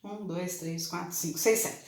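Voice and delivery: a soft, careful recount to check the number. Shown as a demonstration. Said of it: "Se-, tem seis ou sete?".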